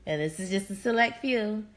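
ordinary delivery

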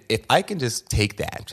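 ordinary talk

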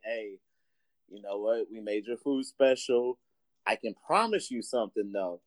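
a hint of an owner promising his best; shop owner. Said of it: "So the owner comes over".

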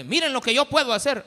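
playacted boast. Acts Moses showing off.

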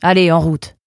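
dry, forceful command; curt. A narrator's reading. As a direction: order them off with no discussion. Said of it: "répondit le garde sèchement".

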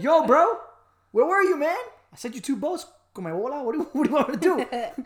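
loud, high, exasperated buddy God; God scolding like a bro; casual God. Pretend. God in heaven scolding the drowned man, loud exasperation. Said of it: "He's like".

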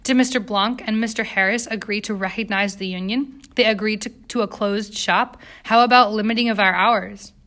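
plain reading voice

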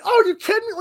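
hammed-up complaining quarterback; sarcastic imitation of a whiner; whiny Tom Brady. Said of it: "Tom Brady also, like, like, whines".

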